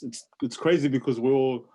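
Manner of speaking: usual speaking voice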